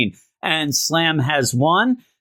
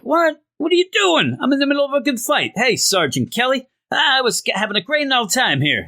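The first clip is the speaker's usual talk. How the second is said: high, fast, cheerfully indignant protest; gleeful brawler; 11 semitones higher than usual. Retold or imitated. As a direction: squeal with delight at being interrupted mid-fight. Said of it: "And he's like".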